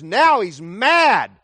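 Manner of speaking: loud, high warning cry; child shouting to father, alarmed. Acted. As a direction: yell a warning to dad. Child alarmed as Jesus acts.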